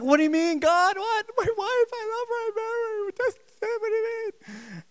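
mock whimper to God about his wife. Preacher imitating his younger self to congregation, high whimpering. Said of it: "I was whimpering out".

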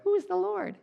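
very high, squeaky and comic; silly squeaky voice. Retold or imitated. Preacher mocking how Pharaoh surely didn't sound. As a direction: squeak it like a cartoon. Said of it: "I don't think he went".

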